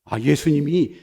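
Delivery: ordinary talk, nothing marked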